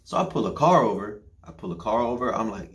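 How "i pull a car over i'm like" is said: usual speaking voice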